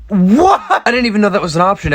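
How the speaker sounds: high, loud laughing disbelief; fast, stunned laugh; shocked. Real, not acted. Shocked at result.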